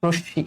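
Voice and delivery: রশ্মি as "ro-sh-shi", with a round o; o sound. Shown as a demonstration. Teacher wrapping up the correction, রশ্মি as "rosh-shi". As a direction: give the r of রশ্মি an o, then double sh. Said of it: "এখানে তোমাকে ওকার দিতে হবে".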